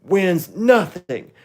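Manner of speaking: loud, high, slow hype-host shout; overexcited YouTuber announcing a prize; MrBeast impression. Shown as a demonstration. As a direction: ham it up like a giveaway host. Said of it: "It's my first Mister Beast impression".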